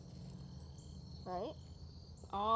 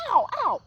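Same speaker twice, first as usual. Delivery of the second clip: real ow; 7 semitones higher, 7 dB louder than usual. Real, not acted. Cat bites her.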